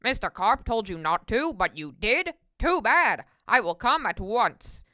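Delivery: low, loud officer's voice; deep-voiced cop taking charge; stern policeman. A narrator's reading. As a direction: answer the caller with gruff authority.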